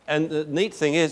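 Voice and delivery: usual speaking voice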